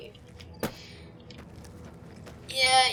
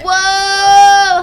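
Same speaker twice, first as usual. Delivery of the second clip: startled cry; 7 semitones higher, 10 dB louder than usual. Real, not acted.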